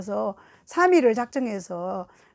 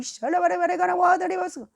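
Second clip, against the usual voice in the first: high-pitched imitation of frenzied tongues; fierce tongues; 7 semitones higher than usual. Imitating the wife suddenly bursting out in tongues, fierce. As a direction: spill out nonsense syllables, raised and urgent. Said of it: "과격하게 방언을 하면서 막 울고".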